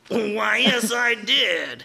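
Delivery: a hammed-up coy yes; mock coy reply. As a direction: stretch it out coyly. Improv character answering coyly.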